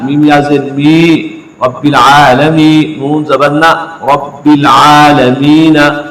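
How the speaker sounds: slow, sung spelling of "आलमीन"; spelled recitation. Shown as a demonstration. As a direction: chant it piece by piece. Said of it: "अब देखिए". (हिज्जे करके तिलावत)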